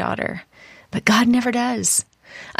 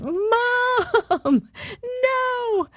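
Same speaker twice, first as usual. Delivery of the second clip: retold child's scream. Daughter crying out to mom as their hands rip apart, mock scream. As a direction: imitate a terrified kid wailing in a high voice. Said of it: "and she screamed".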